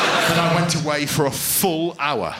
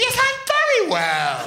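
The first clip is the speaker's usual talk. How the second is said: high, drawn-out forties housewife falsetto; Karen Powell; slower than usual. Acted. Pupil answering her teacher, repressed housewife voice. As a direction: trill it slowly, prim and high. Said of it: "This is how she spoke".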